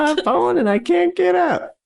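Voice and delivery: very high, whiny mock plea; mock distress wail. Co-host plays someone who has fallen, hammed-up wail. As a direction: wail it like a hammed-up victim calling for help. Acts out a fallen person pleading.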